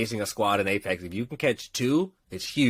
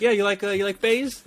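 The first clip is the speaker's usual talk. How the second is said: curious stranger; 9 semitones higher than usual. Retold or imitated. Imitating the guy asking again.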